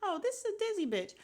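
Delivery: high-pitched mock dismissal; high, sassy retold putdown; imitating Sheree. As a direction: write her off in a high mimicking voice. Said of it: "She's like".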